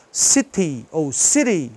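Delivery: slow "city ou city", clear ee endings, first a t then a tap; voiced final Y. Shown as a demonstration. Teacher modelling both variants, clear Y in "city". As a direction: give both "city" a full ee, second t tapped. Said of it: "tem que pronunciar".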